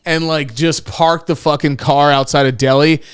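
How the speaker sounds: normal talking voice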